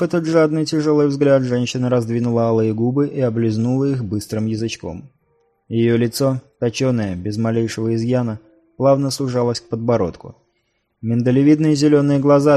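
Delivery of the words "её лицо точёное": ordinary delivery, nothing marked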